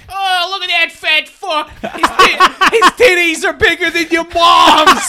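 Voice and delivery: high, loud jeering shout breaking into laughter; kid bully shout. Retold or imitated. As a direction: bellow the insult like a nasty schoolkid, cracking up. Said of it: "the little bully kid is like".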